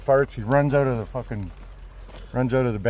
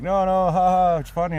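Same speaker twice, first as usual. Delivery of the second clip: laughing Duncan; 8 semitones higher, 5 dB louder than usual. Retold or imitated. Duncan voiced in story.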